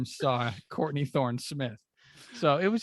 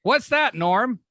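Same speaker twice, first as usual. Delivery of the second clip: much higher, louder mock Conan question; mock Conan cue; 9 semitones higher, 7 dB louder, slower than usual. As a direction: play the host feeding the line, pitched way up. Conan's voice asking Norm.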